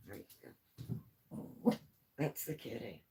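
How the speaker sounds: quiet soothing coo; soft pet talk. Real, not acted. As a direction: settle the cat down softly. Soothing the cat.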